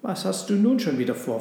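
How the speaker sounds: mildly suspicious cat. A narrator's reading. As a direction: ask warily, as the cat. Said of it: "fragte sie misstrauisch".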